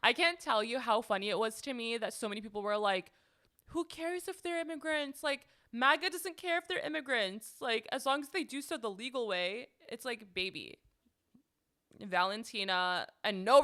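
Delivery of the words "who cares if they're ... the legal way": high-pitched mimicry of dismissive supporters; mock dismissive quote. Quoting supporters who excuse immigrant status, mockingly dismissive. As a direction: imitate others waving it away, pitched high.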